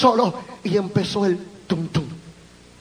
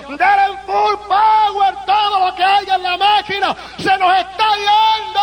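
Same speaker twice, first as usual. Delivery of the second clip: frantic doctor shouting orders; urgent doctor's call; 7 semitones higher, 3 dB louder than usual. Retold or imitated. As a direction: call for more power in a panic. Doctor urgently calling for power.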